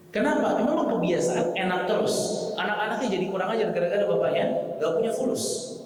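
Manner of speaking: high, loud nagging complaint; scolding wife. Acted. Wife blaming her husband over money, scolding.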